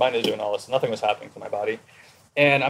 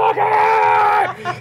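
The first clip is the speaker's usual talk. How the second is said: very high, drawn-out acted scream; furious outburst; 5 dB louder, much slower than usual. Locked-up child ego breaking through the door, furious scream. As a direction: scream it like a raging child bursting free.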